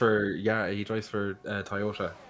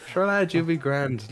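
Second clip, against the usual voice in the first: high, slow, hammed-up Irish brogue; stage Irish; 7 semitones higher, 5 dB louder than usual. Irish co-host mocking his own accent with a high, hammed-up brogue. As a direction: ham up an Irish accent with a lilt.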